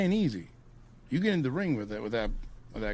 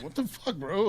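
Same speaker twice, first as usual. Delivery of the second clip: baffled reaction; 7 semitones higher than usual. Acted. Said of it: "You're like".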